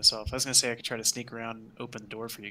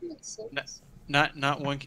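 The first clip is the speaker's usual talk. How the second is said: ordinary talk, nothing marked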